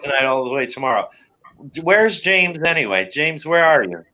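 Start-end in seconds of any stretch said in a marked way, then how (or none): none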